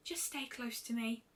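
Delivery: gentle and shy, barely above a hush; soft Fluttershy voice. A narrator's reading. Fluttershy reports on the climb, soft and timid.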